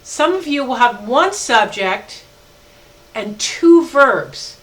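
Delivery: very slow, stress on "two"; teacher stressing a key rule; emphatic slow point. Shown as a demonstration. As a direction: lean hard on "two verbs". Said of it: "let me make a really important point here".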